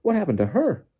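high, loud astonished question; loud startled cry; Bagman startled. A narrator's reading. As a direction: exclaim it in shock, pitch leaping. Bagman notices Winky lying there.

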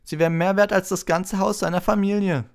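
high, loud, disdainful jibe; mocking Malfoy. A narrator's reading. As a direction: sneer at his poverty. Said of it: "spottete Malfoy".

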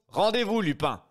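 loud command. Acted. Brigadier Folenfant repeating his order to Lupin, shouting. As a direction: bellow the surrender order.

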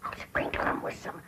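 soft unvoiced whisper. Real, not acted. Granny whispers her plan to Jed.

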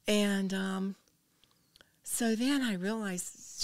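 normal talking voice

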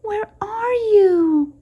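slow, high, frightened squeak. A narrator's reading. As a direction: plead like a lost baby bat. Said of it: "Stella Luna squeaked".

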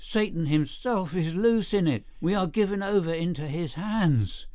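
high, frantic, pleading; frantic cry. Acted. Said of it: "so excited that he could hardly articulate".

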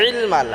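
ইলমাল opening on a squeezed throat ayn. Shown as a demonstration. Teacher modelling correct ইলমাল, throat-pressed ayn. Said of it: "আইন দিয়ে উচ্চারণ করতে হবে".

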